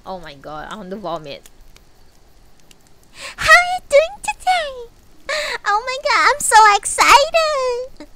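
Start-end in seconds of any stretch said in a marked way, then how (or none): 3.3-8.1 very high, loud and gushing; high-pitched cutesy VTuber voice. Shown as a demonstration. Vtuber mocking cutesy streamers to chat, squealing high. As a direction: gush like an overexcited cute streamer. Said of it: "that type of voice".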